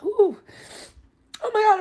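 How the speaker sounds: high, loud, fast and overcome; emotional burst, near tears; about to cry. Real, not acted. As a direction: gush it out, choking up. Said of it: "I'm gonna cry again".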